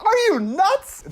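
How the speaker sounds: high, loud, drawn-out disbelief; mock outraged yell. Friend quoting the part she can't get over, mock outraged yell. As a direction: shout it in a cracked-up high pitch. Acting out outraged film line.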